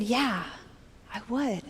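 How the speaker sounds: soft, subdued retold reply; softest voice. Stranger accepting her offer of encouragement, in the softest voice. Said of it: "in the softest voice".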